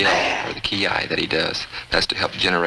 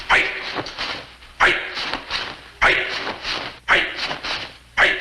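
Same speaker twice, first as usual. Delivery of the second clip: shouted command. Acted. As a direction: drill sergeant shouting fight. Instructor calling sparring commands.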